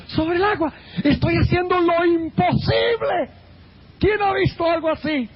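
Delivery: high-pitched, amazed self-congratulation; acted boasting. Peter showing off to companions on the water, boastful excitement. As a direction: crow about an incredible feat, pitch well up.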